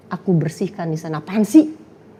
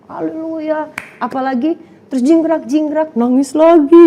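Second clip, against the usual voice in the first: normal talking voice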